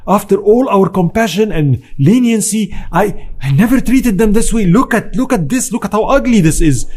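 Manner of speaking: a hint of furious disbelief; retold fury. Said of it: "Salah ad-Din was furious".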